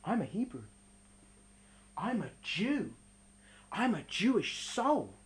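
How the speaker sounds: low, soft proud confession; solemn quiet declaration. Acted. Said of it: "he said to them".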